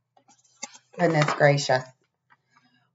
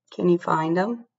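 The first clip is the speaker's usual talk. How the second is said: ordinary delivery, nothing marked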